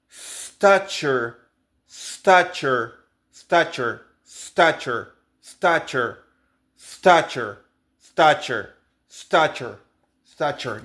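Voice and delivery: "stature" with the tu as a clear ch; ch in the middle. Shown as a demonstration. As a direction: say "stature" as stachure. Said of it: "una che franca". ("stature" con una che franca)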